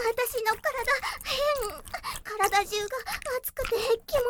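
high and loud, rushing to a peak; breathless, building to a climax; climactic cries. Acted.

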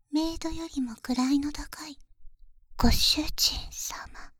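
slow pointed whisper. Acted. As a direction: stretch it out in a hush. (ゆっくりささやく)

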